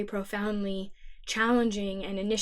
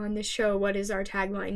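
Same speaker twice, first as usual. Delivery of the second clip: normal talking voice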